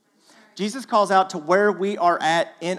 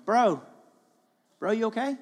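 concerned call; 7 semitones higher than usual. Retold or imitated. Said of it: "I picture Jesus yelling out to me going".

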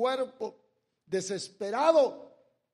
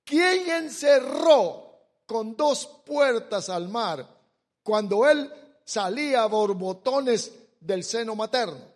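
loud, slow, solemn scripture read; preaching scripture reading; 3 semitones higher, 7 dB louder than usual. Acted. Preacher reads Job to the congregation, booming. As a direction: proclaim the verse to the congregation.

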